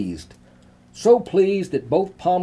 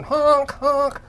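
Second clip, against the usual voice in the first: honk imitation; 12 semitones higher than usual. A narrator's reading. Imitating bicycle horn.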